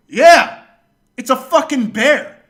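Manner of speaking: very loud, high comeback; indignant yell. Retold or imitated. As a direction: shout back as if it's obvious, acted out.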